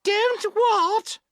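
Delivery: put-on very high, loud girlish call; calling back. A narrator's reading. Girls who misheard the boatman, calling back. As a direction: shout a puzzled question in a girl's voice.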